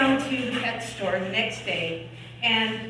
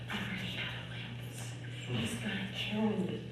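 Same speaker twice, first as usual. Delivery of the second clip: very soft, nearly voiceless victim; hushed, halting and worn down; acted weak plea; 4 semitones lower, 12 dB quieter, much faster than usual. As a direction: plead feebly, as if badly hurt. Acts injured woman pleading at store.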